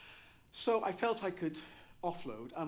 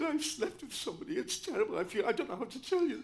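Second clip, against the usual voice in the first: mock distress; 7 semitones higher, 6 dB louder than usual. Retelling his confession to John.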